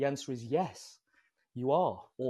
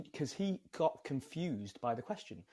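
ordinary talk

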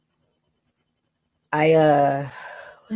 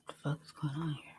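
whisper; 19 dB quieter than usual. Real, not acted. Frustrated with screen.

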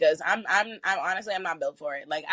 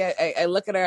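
ordinary talk

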